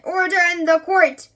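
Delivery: loud, high call for order; commanding judge. Acted. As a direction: bellow it over the courtroom. Judge bangs head, calls for order.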